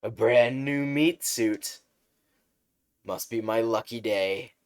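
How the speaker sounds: slime monster relishing his catch; gloating sludge villain. Acted. Sludge villain gloating over victim.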